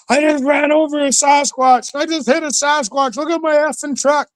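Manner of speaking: high, panicked blurting; frantic. Retold or imitated. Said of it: "he's all frantic and huffing and puffing".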